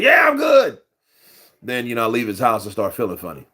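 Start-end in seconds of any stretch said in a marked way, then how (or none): 0.0-0.8 brother's cheerful answer. Retold or imitated. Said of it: "I'm like "You good?".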